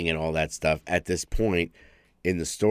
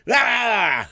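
a sudden much higher, louder cry; short mock scream; 7 dB louder than usual. Retelling the character's death with a sudden high mock yell. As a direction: yelp it as if you are getting torn apart.

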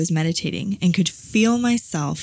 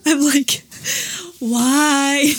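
crying; 9 semitones higher, much slower than usual. Real, not acted. Crying on the podcast, high tearful plea. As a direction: ask it through real tears. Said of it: "I'm, like, crying right now".

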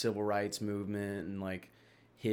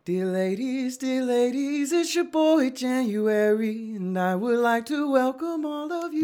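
high, slow, crooning smooth-talker voice, far higher than his own; R. Kelly impression; 7 dB louder than usual. A singer greets the ladies at the album's start, in a high crooning voice. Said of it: "he's talking about his".

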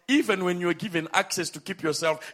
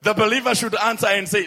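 usual speaking voice

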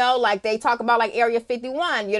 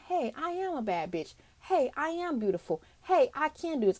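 self-affirmation; 5 semitones higher, 4 dB quieter than usual. Acted. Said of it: "and actually saying".

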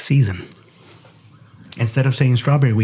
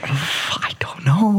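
slow breathy whisper; a slow whispered shrug; 5 semitones higher, much slower than usual. Real, not acted. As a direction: whisper it, embarrassed. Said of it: "embarrassed about the saying".

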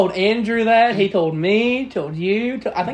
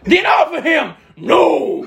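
mock shouting; 6 semitones higher, 4 dB louder, much slower than usual.

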